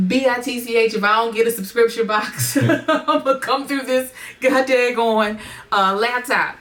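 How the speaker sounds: high-pitched mock threat, playfully outraged; playful indignant rant with a laugh; mock-indignant fans. Acting out impatient subscribers' threat.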